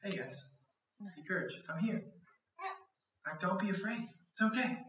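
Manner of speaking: gentle, hushed comfort; perfect peace. Acted. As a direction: soothe frightened friends in a storm. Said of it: "Jesus comes in with perfect peace".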